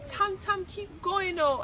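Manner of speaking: high, slow excited shout. Acted. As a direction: cheer him on, drawn out and high. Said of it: "Ajamu shouted".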